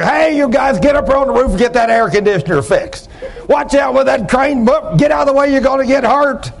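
high, urgent hollering over machinery; demonstrated job-site yell. Retold or imitated. Boss warning his crew on a noisy job site, hollering. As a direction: holler orders up to a roofing crew. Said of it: "and you scream at them".

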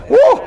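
high, loud "woo"; exultant whoop. Real, not acted. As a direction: let out a piercing whoop of joy. Preacher overcome with excitement.

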